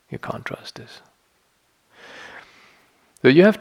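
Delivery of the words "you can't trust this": breathed with no voice at all; whisper. Shown as a demonstration. Whispering a warning to the listener about a thought. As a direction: drop to a hush for the warning.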